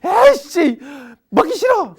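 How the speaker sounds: loud, high, fast angry rant; angry child whining. Retold or imitated. Child snapping at mom about how hard life is, shouting high and fast. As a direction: yell your hurt at mom like a sulking teen. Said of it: "왜 화내면서 집에 아주 힘들다고 막 하는 거야".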